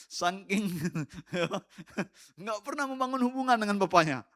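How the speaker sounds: high, loud voice broken by laughter; laughing. Real, not acted. Preacher laughing at his own joke about believers, cracking up. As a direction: talk through real laughter.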